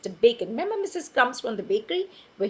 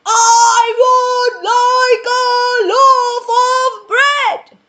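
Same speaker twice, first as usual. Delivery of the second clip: very loud, high, slow shouted order; louder booming shout; 12 semitones higher, 16 dB louder, much slower than usual. Acted. Mister Noisy shouting his order louder at the baker. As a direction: shout it even bigger. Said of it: "even louder".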